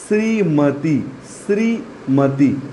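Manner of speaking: श्रीमती drawn out slowly, clear श्री; slow careful word. Shown as a demonstration. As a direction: dictate श्रीमती slowly for the class. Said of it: "श्री में ये वाला होगा".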